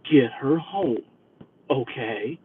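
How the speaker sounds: soft, low ominous aside; quietly ominous; ominous hush. Retold or imitated. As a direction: hush it like a secret warning. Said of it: "she sounded rather ominous with that".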